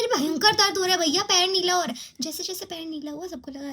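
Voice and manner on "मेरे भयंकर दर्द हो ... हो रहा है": higher, louder desperate plea about the pain; pleading about pain. Retold or imitated. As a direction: insist loudly to the doubting friends that it really hurts. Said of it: "मैं सीख रही हूँ". (ऊँची, ज़ोरदार आवाज़ में दर्द की गुहार)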